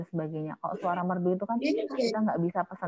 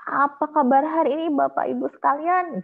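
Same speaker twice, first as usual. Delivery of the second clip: higher and louder, full-voiced announcer welcome; powerful MC greeting; 7 semitones higher, 6 dB louder than usual. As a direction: project it like an emcee opening the show. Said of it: "Kapan kita seperti Bunda Fitri". (sapaan MC yang lantang dan bertenaga)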